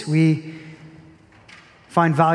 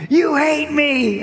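put-on much higher, slow child's accusation; sulky daughter accusation; 10 semitones higher, 3 dB louder, much slower than usual. Daughter accusing her dad who says he loves her, sulky. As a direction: drag it out like a hurt little girl.

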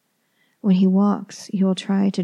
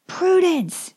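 yelling; 11 semitones higher than usual. A narrator's reading. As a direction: scream her name through the house. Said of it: "he yells again".

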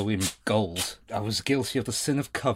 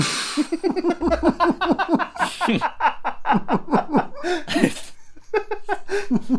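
big laugh; 5 dB louder than usual. Real, not acted. Laughing at own joke.

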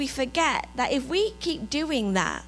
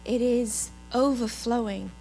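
low, measured worship reflection; hushed, unhurried devotional voice; reflective devotional; 5 semitones lower, 10 dB quieter than usual. Real, not acted. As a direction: guide them gently into calm, leaving long pauses. Guides congregation in reflection.

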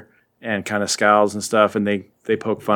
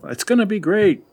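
higher, upbeat mock reassurance; reassuring nephew; 9 semitones higher than usual. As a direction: voice a nephew cheering up his aunt. Voices Peter reassuring his sour aunt.

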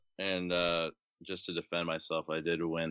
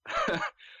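laugh. Real, not acted. Laughs at Peter Griffin joke.

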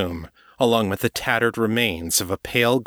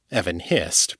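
usual speaking voice

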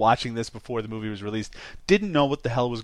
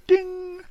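high-pitched "ding"; imitated idea chime. Podcaster voicing Dorothy getting an idea, high chime. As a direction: sing out a little bell sound.

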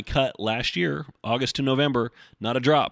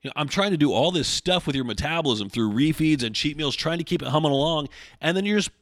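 exasperated, higher-pitched rant; fed-up, raised-pitch venting; pissed off; 6 semitones higher than usual. Real, not acted. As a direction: vent real frustration at clients. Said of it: "I get a little fucking pissed".

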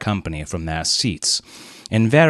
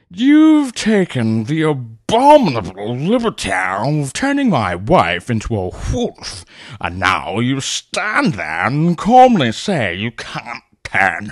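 slow, louder outraged colonel; furious colonel; 5 semitones higher, 4 dB louder than usual. A narrator's reading. Colonel accusing Leonard over his wife, slow fury. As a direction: hammer him with slow indignation. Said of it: "shouted Colonel Hampton".

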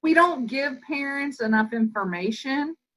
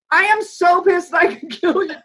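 high, loud, seething outburst; hammed-up furious mom yelling; furious parent; 7 semitones higher, 6 dB louder than usual. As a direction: let the fury burst out in mock rage. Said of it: "And you're like".

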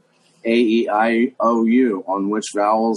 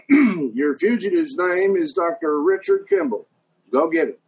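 put-on Southern accent, a stern marshal's order; Tommy Lee Jones; 9 semitones higher than usual. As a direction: give the order as a Southern lawman. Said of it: "Tommy Lee Jones today".

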